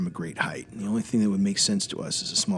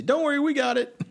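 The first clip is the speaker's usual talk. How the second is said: mock reassurance; 5 dB louder than usual. Mock reassurance to ambulance crew.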